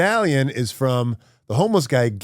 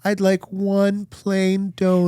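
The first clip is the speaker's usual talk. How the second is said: put-on high, slow small-boy voice; birthday boy asking his dad for a donut; little kid voice; 9 semitones higher, slower than usual. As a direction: ask sweetly and modestly. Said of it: "the little kid goes".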